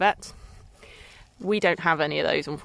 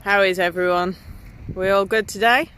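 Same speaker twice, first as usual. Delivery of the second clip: out of breath; 4 semitones higher than usual. Real, not acted. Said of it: "sorry if I sound slightly out of breath".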